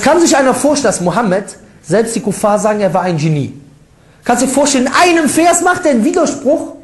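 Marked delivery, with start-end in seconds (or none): 4.2-6.7 incredulous. Acted. Rhetorical disbelief at critics' claim.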